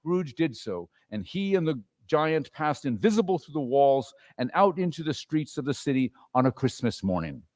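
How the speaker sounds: usual speaking voice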